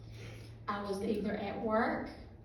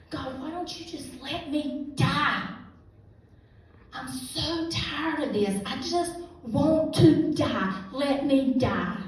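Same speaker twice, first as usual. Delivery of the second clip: slow, higher and louder pleading; desperate plea; 4 semitones higher, 5 dB louder, slower than usual. Retold or imitated. Worn-out woman begging God to let her die, slow and desperate. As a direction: drag out each word, begging to be let go.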